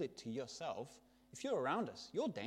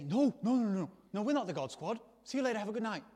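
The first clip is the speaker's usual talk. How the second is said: higher, louder mock protest; hasty denial; 6 semitones higher, 7 dB louder than usual. Evangelist brushing off the gang member, mock hasty denial. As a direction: deny it quickly, raised voice, in light play-acting.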